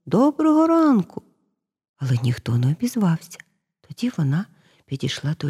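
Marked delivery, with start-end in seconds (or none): 0.0-1.2 high, rising call of greeting. A narrator's reading. Girl greeting a silent house, calling out. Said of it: "голосно гукнула".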